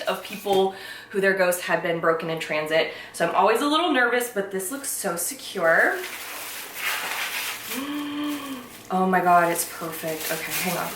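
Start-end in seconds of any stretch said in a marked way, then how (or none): 6.0-8.6 hushed gasp of wonder; soft breathy gasp. Real, not acted. Seeing the figurine unwrapped, a soft gasp. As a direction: catch your breath at how perfect it is.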